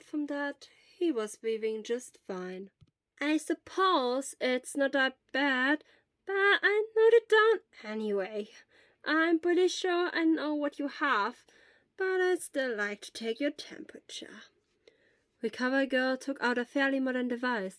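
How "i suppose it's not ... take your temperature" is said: put-on slow, gentle questions from an old nurse; lightly voiced elderly nurse. Elderly school nurse gently questions a feverish boy. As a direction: ask slowly and calmly, like a school nurse.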